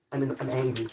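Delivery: low, quiet pretend anger; mock angry voice. Frustrated with the stripped screw, mock angry. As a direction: put on a low grumbling angry voice. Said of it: "This is my angry voice".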